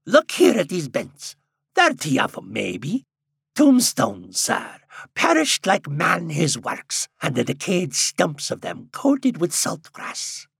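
put-on high, loud old countryman; old man voice. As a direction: speak as an excited old villager. Old man points at mounds.

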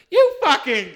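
high, loud furious yell; manager yelling. Retold or imitated. Manager spins around yelling at customer, loud and high. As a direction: shout it in outrage. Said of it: "My manager spins around and goes".

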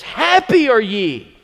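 high, loud, slow proclamation; proclaimed verse. Shown as a demonstration. Pastor proclaims the verse to his church, loud and slow. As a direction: hammer the verse home. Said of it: "And so he says".

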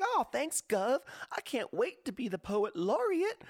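high, gushing fake gratitude; fawning suck-up. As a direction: gush like a flattered yes-man. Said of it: "he's gonna be like".

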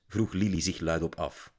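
ordinary delivery

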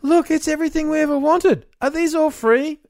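put-on high, thrilled little girl; excited kid voice. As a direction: gasp it out like a delighted kid.

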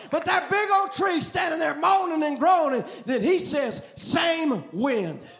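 loud, driving revival sermon delivery; impassioned preacher, rising to shouts; full-force preaching. Real, not acted. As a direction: preach it hard, stretching the big phrases.

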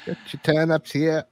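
high-pitched vendor's cry; market barker. Acted. Vendor selling turnips in town, calling out. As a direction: hawk your goods to passers-by.

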